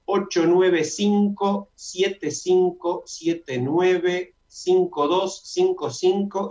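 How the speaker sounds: very slow number read-out, digit groups spaced apart; slow dictation. Shown as a demonstration. Host giving listeners a Zoom number, slow dictation. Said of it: "Lo voy a decir lentamente".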